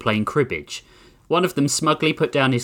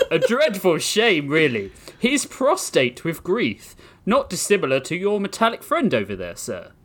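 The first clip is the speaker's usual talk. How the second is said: posh British gentleman; 5 semitones higher than usual. Acted. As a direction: savour the mock sorrow, very English. Sir Thomas voice.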